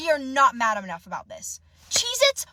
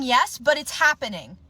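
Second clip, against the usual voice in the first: ordinary talk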